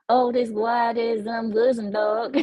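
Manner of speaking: slow, mock southern drawl on the words. Shown as a demonstration. Streamer tries Austrian greeting for chat in southern drawl. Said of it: "say those letters with a southern drawl".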